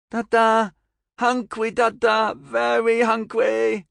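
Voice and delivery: put-on very high, loud little-child cry; toddler voice. A narrator's reading. Toddler calling to her father for food, babyish. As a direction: pipe up as a demanding hungry toddler. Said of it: "he picked up the toddler".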